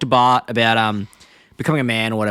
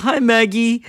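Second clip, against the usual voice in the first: put-on high, cooing hello to a baby; sweet greeting; 10 semitones higher, 3 dB louder, much slower than usual.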